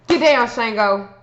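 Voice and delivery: sharp interjection to a cat; calling off a pet. Real, not acted. Speaker interrupts the video to order the cat down, scolding.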